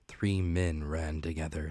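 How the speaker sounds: ordinary delivery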